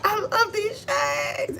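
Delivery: high squealing, drawn-out and slow; ecstatic drunk woman. Retold or imitated. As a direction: squeal with delight, stretching every word. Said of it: "she's like".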